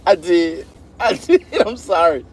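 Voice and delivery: real laughter, voice jumping high; laughing admission. Real, not acted. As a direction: confess it through high-pitched laughter. Laughing admission of bad accent.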